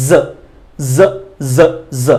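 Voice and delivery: জ as a voiced buzzing z, repeated; buzzing z sound. Shown as a demonstration. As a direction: vibrate inside the mouth on জ. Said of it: "মুখের মধ্যে ভাইব্রেশনটা আনতে হবে". (মুখে কাঁপিয়ে জ)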